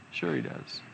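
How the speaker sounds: whispered, knowing reply; whispered aside. Acted. Preacher answers his own question in a whisper. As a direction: answer yourself under your breath.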